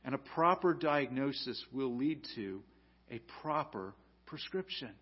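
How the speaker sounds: ordinary talk, nothing marked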